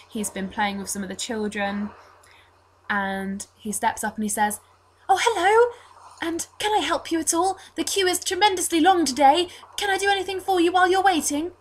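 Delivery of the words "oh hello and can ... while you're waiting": put-on high, chirpy young altar boy; [name]. Altar boy offering help to someone queuing, high and eager. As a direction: play an eager, smiley twelve-year-old, pitched well up. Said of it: "twelve-year-old-ish looking blonde altar boy".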